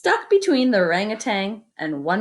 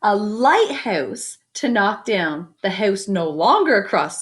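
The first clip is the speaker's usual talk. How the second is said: lively picture-book reading; story narration; 3 dB louder, slower than usual. A narrator's reading. Teacher reading a picture book to the class, lively storytelling. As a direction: read it out to the kids with wonder.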